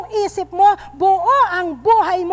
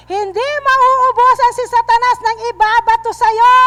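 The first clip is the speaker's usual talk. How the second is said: loud, driving sermon voice; forceful preaching; 4 semitones higher, 5 dB louder than usual. Acted. Pastor urging the church not to stop, loud preaching. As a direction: preach it hard to the congregation.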